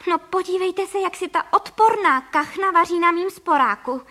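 high, loud outrage; indignant cry. A narrator's reading. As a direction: cry out at the cheek of it. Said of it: "vykřikla paní Frejková".

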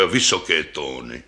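lightly voiced deep, troubled double bass; troubled old bass. A narrator's reading. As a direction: confess a weakness in a deep voice. First bass laments its high notes.

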